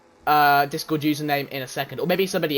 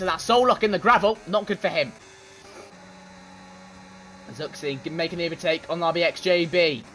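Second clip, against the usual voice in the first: fast, lively play-by-play calling the race; race commentary; 5 semitones higher than usual. Acted. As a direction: call every overtake like a live broadcaster.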